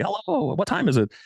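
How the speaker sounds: high, sweet polite voice; sounding nice. Retold or imitated. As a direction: put on a nice, harmless tone. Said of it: "go up and sound nice and say".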